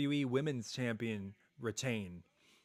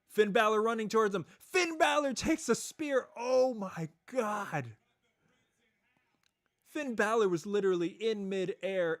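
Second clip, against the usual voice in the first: high, fast, loud hype call; excited call; 7 semitones higher, 5 dB louder than usual. Real, not acted. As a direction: rattle off the spot excitedly. Live call of a spear.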